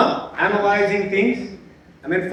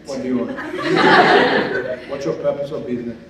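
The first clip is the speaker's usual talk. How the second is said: curt receptionist; 7 semitones lower than usual. Retold or imitated. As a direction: interrogate the visitor like a gatekeeper. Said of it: "They're looking to".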